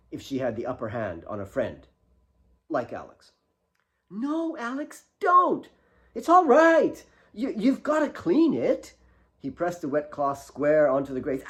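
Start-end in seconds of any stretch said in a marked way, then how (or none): 4.1-8.9 much higher, loud pleading; hurt girl. A narrator's reading. Kate flinching as Alex cleans her knee, pleading. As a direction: protest then give in. Kate stopping then relenting.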